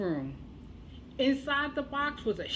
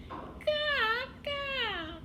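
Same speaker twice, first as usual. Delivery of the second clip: put-on high playful baby coo; baby babble; 14 semitones higher than usual. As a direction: coo like a happy infant. Baby playing with ball.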